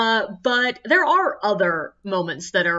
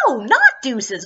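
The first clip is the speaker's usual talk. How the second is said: louder, slow no, not deuces; exasperated rebuttal; indignant retort; 3 semitones higher, 6 dB louder, slower than usual. Retold or imitated. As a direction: throw her word back at her. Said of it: "And I'm like".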